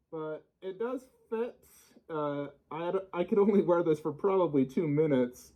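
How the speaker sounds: talking from inside a resin mask; muffled through mask. Real, not acted. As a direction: voice boxed in and dulled by the mask. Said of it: "My voice felt very muffled".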